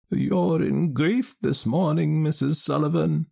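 put-on high, slow wise old woman; a knowing village wise woman. Old woman Ellen Leah speaks.

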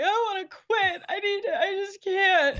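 retold sobbing despair. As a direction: imitate yourself crying that you give up. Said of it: "literally bawling my eyes out and be like".

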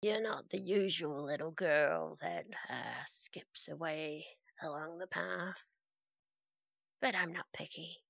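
quiet lurking voice from shadows; dark whisper behind you. Acted. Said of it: "a voice from the shadows say".

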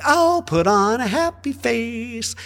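high, slow, fake-cheerful; mock-bright brushing off grief; chirpy mock American. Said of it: "Americans immediately are like".

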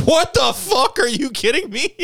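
hammed-up disbelief; mock shock. Teller reacting to boss's praise, mock shocked yell. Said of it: "I was like".

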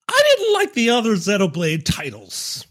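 high, deliberately terrible British accent; bad put-on British accent. Shown as a demonstration. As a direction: ham up a fake English accent. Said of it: "Speaking of accents".

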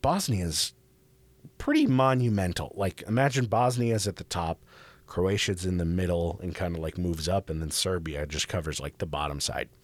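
ordinary talk

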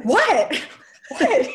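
retold laughing what. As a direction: giggle through a flustered "what". Laughing reply to mom.

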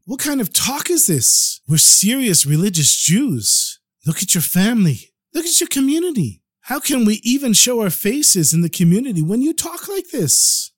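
high-pitched, distraught protest without sobbing; tearful wife. Acted. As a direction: plead with your husband in rising distress. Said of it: "She started crying".

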